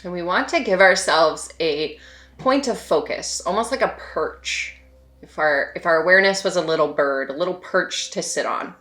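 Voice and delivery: soft, unhurried meditation voice with long pauses; hushed, spacious meditation guidance; guided meditation. Real, not acted. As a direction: gently guide them to settle and notice, leaving space between phrases.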